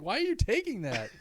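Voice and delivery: high, loud mock indignation; protesting kid. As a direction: imitate an alarmed child objecting. Retold child protests blood sample.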